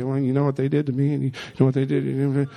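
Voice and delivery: low, quick, softened gossipy grumbling; mock gossip. Preacher mimicking someone talking to six other people, gossipy. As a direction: imitate someone complaining behind another's back, fast and hushed.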